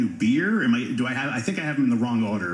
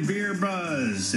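ordinary talk, nothing marked

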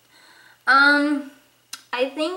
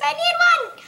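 pleading cry; 14 semitones higher than usual. Acted. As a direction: beg for it like a desperate kid. Begging for a dictionary for Christmas.

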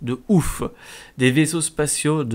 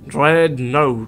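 Dreadnought in a clumsy English accent, louder; attempted English accent; 5 dB louder than usual. Shown as a demonstration. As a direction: try the English accent on Dreadnought, loud and awkward. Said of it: "excusez-moi pour l'accent anglais".

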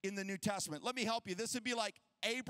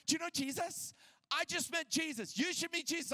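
higher-pitched, bubbling imitation of enthusiasm; eager new convert; 6 semitones higher than usual. Wife tells guy behind the counter, imitated eager excitement. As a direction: play a convert urging a stranger.

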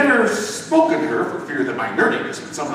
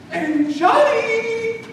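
much higher, slow motherly call; mother's voice; much slower than usual. Acted. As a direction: call him back gently like mom. Mother calls son again.

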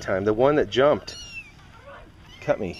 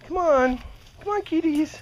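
high kitty call. Real, not acted. Calling kittens.

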